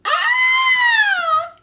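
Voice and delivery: very high, very loud scream; terrified scream. A narrator's reading. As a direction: scream in fright. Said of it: "yelled Granny".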